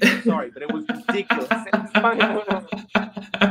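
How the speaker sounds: high, loud, words breaking into laughter; laughing. Real, not acted. Apologising for his ridiculous story, helpless real laughter. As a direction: crack up while apologising, stretching the last words.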